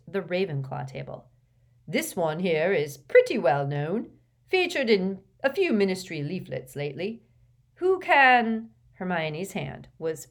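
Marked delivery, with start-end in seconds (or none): 1.9-8.7 put-on loud, higher, hearty old teacher; jovial professor. A narrator's reading. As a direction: boom out instructions cheerfully. Slughorn's voice.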